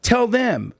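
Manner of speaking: high-pitched, acted-out urging; retold urgent plea. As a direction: imitate pleading with a guard mid-chase. Pleading with guard while being chased.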